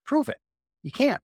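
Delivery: a sudden high, pointed dare; high-pitched emphatic mock dare; emphatic challenge. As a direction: throw down the challenge with a jump up in pitch. Challenging a doubter in mock dialogue.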